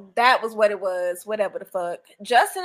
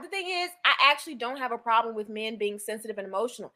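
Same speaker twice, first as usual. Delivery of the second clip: ordinary talk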